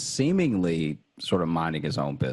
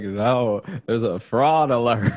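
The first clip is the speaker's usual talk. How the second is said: laughing through words; 8 semitones higher, 6 dB louder than usual. Retold or imitated. Explaining to the date, laughing.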